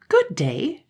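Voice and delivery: cheerful high-pitched good day. A narrator's reading. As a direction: sing out a friendly hello. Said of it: "in the same cheerful voice".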